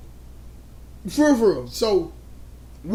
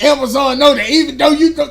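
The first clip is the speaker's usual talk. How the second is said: mocking imitation; 7 semitones higher, 12 dB louder than usual. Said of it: "When you was like".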